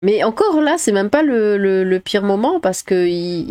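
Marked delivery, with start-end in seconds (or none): none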